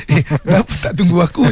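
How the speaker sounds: a light mock complaint; half-joking sulky question; mild reproach. Shown as a demonstration. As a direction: grumble at being left out.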